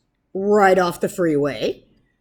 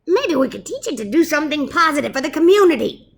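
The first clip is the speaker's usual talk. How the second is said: squeaky mouse; 8 semitones higher, 6 dB louder, faster than usual. Acted. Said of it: "suggested the Big Cheese".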